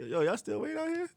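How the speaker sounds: high-pitched retold question; surprised question. Player to waiting fans, retold high and puzzled. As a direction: ask it high, in mild mock disbelief.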